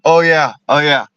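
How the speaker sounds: ordinary talk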